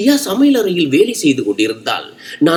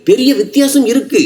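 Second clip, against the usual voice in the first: louder and higher, hitting பெரிய வித்தியாசம் hard; loud angry retort; 7 semitones higher than usual. A narrator's reading. Husband snapping back at his pregnant wife, loud and angry. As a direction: raise your voice on பெரிய வித்தியாசம் இருக்கு. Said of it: "என்று நான் உரத்த குரலில் சொன்னேன்".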